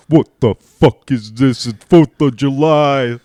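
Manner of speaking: loud, high, slow drawn-out bellow; outraged dad. Retold or imitated. Son imitates his outraged dad on Fourth of July, furious bellow. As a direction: bellow every word in mock fury. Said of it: "He was so mad".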